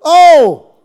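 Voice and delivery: high, loud oh with a sob in it; cry of longing. Shown as a demonstration. Preacher demonstrating Paul's anguished oh, crying out. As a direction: ache as you cry it out. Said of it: "It's an".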